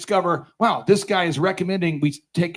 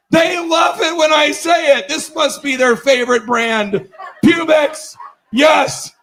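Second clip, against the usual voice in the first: higher, slow, emphatic crowing; oblivious triumph; 5 semitones higher, slower than usual. Retold or imitated. Speaker thinking the crowd adores him, triumphant crowing. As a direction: crow it like you're winning the room. Acts out oblivious elation on stage.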